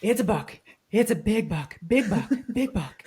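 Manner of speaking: imitating a stunned, urgent report; excited partner. Partner alerting hunter to a big buck, excitedly. Retelling partner spotting the deer.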